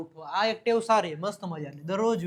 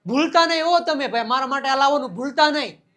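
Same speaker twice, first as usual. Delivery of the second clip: loud, higher-pitched Kathiyawadi reminder; Kathiyawadi speech; 5 semitones higher, 6 dB louder, faster than usual. Acted. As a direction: call out a reminder in everyday Kathiyawadi. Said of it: "આપણે કાઠિયાવાડીમાં બોલતે".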